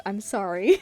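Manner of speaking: ordinary delivery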